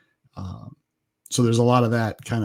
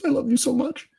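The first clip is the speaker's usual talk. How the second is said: mock tender; 13 semitones higher than usual. Husband's mock tender reply to wife.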